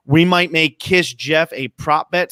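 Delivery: usual speaking voice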